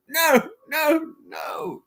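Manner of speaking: high cries of no, the last one only breathed; defeated no dying away; mock despair, fading out. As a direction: start with a wail and end in a gasp. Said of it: "you see another piece come out".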